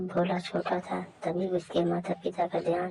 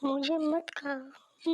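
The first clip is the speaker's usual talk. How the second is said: put-on high, slow terrified child begging; high, slow scared boy pleading; frightened plea; 7 semitones higher, much slower than usual. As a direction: plead for your life.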